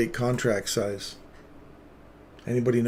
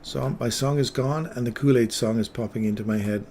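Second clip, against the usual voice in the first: ordinary talk, nothing marked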